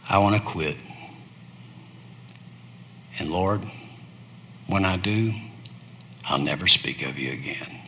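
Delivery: very soft and low, nearly gone; weary surrender. Acted. Preacher voicing a worn-out Jeremiah quitting, barely above a breath. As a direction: sigh out the giving up.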